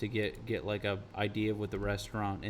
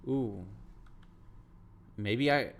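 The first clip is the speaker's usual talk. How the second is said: normal talking voice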